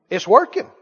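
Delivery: ordinary delivery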